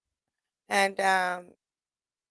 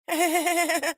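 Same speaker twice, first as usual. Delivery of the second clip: higher-pitched rapid chuckle; taunting chuckle; 7 semitones higher than usual. Retold or imitated. Laughing at an L A rival after boasting of research, tauntingly. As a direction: cap the boast with a run of giggles. Laugh capping the retold comeback.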